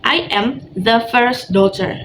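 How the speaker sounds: slow, clear teacher model; slow model reading. Shown as a demonstration. Teacher models a line for the class to repeat, slowly. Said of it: "repeat after me".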